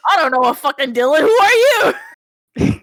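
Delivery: loud hammed-up outburst, climbing high; mock indignant. Friend acting out high Lucas not knowing Dylan, mock indignant.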